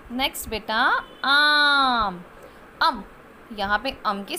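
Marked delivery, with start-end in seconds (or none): 1.2-2.2 आम with a long loud आ; teacher drawing out the आ; long vowel demo. Shown as a demonstration. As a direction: stretch the आ of आम. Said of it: "अ के पीछे आ की मात्रा".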